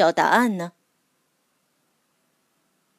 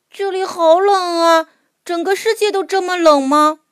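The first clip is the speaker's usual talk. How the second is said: put-on high, shivery child voice; small creature feeling the cold; cold little mole; 7 semitones higher than usual. A narrator's reading. Mole in icy waters.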